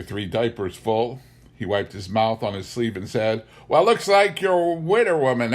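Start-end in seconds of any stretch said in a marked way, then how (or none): 3.7-5.5 teasing hillbilly neighbor. Acted. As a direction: needle her with a grin. Alwyn teases the narrator.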